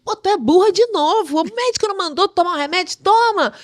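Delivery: high, loud, fast mock scolding; scolding friend. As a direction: bark it at her like a bossy friend. Friend scolding again, retold.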